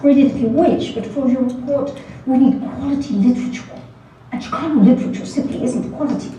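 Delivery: crisp pseudo-British dismissal; a lightly faked British accent, condescending; pseudo-British professor. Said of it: "in his crisp, pseudo-British accent".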